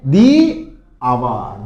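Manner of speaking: दी आवर very slow, dee hour with h silent; slow model answer. Shown as a demonstration. Teacher to students modelling the answer slowly. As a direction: stretch दी आवर. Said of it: "तो क्या बोलोगे".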